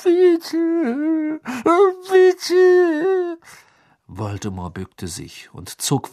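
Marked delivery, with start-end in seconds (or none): 0.0-3.4 high, desperate pleading; begging whimper. A narrator's reading. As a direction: plead in a thin whine. Servant begs the master.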